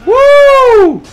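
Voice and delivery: high, loud, drawn-out woo; excited whoop. Real, not acted. Gamer cheering at the action, excited whoop. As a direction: let out a long high whoop.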